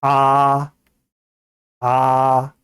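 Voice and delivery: 아 held long and level; unchanging vowel. Shown as a demonstration. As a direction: sustain 아 with one mouth shape throughout. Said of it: "입 모양이 변하지 않고 발음되는 거예요".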